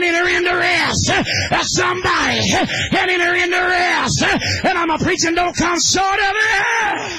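chanted preacher's cadence, half shouted; whooping holiness sermon; preaching at full force. Real, not acted. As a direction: drive it home like a revival preacher.

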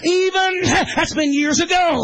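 chanted preacher's cadence, half shouted; whooping holiness sermon; preaching at full force. Real, not acted. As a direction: drive it home like a revival preacher.

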